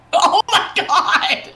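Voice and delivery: loud, high, drawn-out laughing cry; laughing disbelief. Real, not acted. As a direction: exclaim it through real laughter. Bursts out at unexpected roll.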